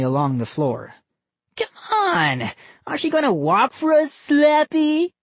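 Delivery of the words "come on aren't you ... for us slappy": put-on very high fast taunting boy; taunting kid voice. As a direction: goad it like a bratty kid. Boy character voice.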